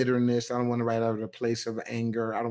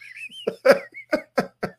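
high breathy laugh; 13 semitones higher than usual. Real, not acted. Laughs at his own remark.

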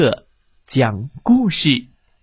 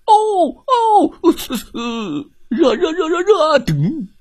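high yelping hot hot; bear howling in pain; 13 semitones higher than usual. Acted. Bear's hand in the hot pot, yelping in pain. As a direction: howl like a burnt paw on the stove. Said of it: "惨叫着".

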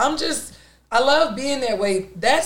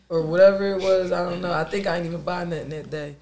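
usual speaking voice

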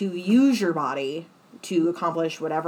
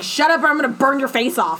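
raised, higher mock threat; retold threat; 5 semitones higher, 4 dB louder than usual. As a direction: imitate an irritated threat. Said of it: "trying to threaten me".

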